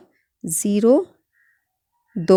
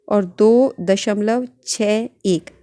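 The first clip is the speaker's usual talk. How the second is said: slow dictation; much slower than usual. Shown as a demonstration.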